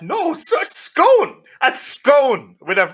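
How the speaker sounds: can't believe what he's hearing; indignant disbelief. Real, not acted. Mate offers a scone with his fry, outraged squeal. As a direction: pitch leaps high on a stunned scone.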